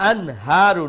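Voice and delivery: loud "Anharu" with a crisp, un-nasalised n before the h; izhar, clear nun. Shown as a demonstration. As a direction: say the n of "Anharu" cleanly, no hum. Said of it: "nun sakin will be pronounced here with clarity".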